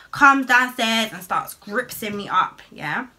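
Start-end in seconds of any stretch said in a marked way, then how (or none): none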